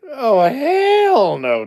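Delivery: high, loud laughing "hell no"; laughing refusal. Real, not acted. Laughing hard at a viewer's cabin idea.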